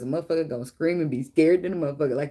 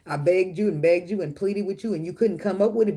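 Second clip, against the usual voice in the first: ordinary talk, nothing marked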